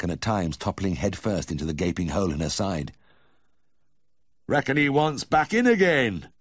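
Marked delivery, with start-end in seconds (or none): 4.5-6.3 loud, high Yorkshire farmer with a grin; grinning farmer. A narrator's reading. Farmer joking to vet about the calf, loud and grinning. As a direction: joke loudly through a grin. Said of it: "Mister Bushell said with a grin".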